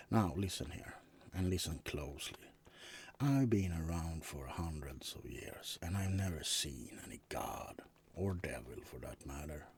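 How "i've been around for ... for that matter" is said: whisper. Acted. Vampire whispering his old secrets to his roommate. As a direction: share it as a dark secret.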